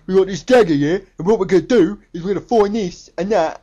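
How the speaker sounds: loud, mock English thug accent; Jake Gyllenhaal. Reviewer mocking the lead actor's English accent, loud thuggish impression. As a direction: boom it out like a loutish English thug. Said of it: "He sounded like a fucking thug".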